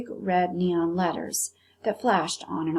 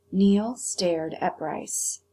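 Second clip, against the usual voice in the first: usual speaking voice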